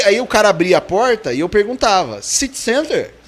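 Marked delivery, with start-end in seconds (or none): 2.2-3.1 CITY CENTER as a high-pitched question; questioning intonation. Retold or imitated. Newcomer asking the bus driver if it's going downtown, questioning intonation. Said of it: "Você põe uma entonação".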